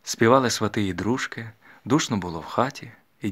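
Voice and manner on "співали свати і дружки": usual speaking voice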